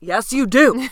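loud, insistent mock argument; playful loud insistence; emphatic retort. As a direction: contradict her loudly, teasing. Mock argument with sister's denial.

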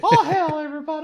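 high squeaky voice; helium voice. Pretend. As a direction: squeak it like you inhaled helium. Continuing Little Doug voice.

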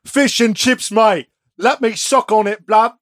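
pub-bloke Dracula, hammed up; Cockney-Aussie Dracula. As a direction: ham up the fish-and-chips vampire. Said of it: "That's Australian".

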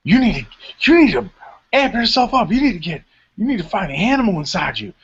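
high, pumped-up coaching voice; hype-man shouting. Retold or imitated. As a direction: fire him up like a locker-room hype man. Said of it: "and he's like".

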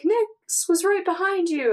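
lightly acted retort, pitched high; high-pitched quoted line in a recap; Daniel. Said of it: "And Daniel was like".

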